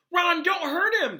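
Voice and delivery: high, loud panicked squeal. A narrator's reading. Hermione pleading with Ron to spare her cat, high squeal. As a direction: squeal it in alarm. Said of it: "squealed Hermione".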